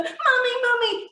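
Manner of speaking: put-on high, loud child's cry for mum; acted child wailing for mummy; frightened children. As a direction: call out like a distressed little kid. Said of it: "And the kids, they run to her".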